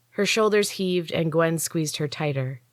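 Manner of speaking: plain reading voice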